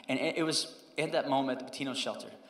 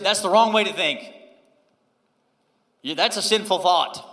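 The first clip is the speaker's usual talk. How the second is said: mock scolding; 3 semitones higher, 8 dB louder than usual. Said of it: "He never said".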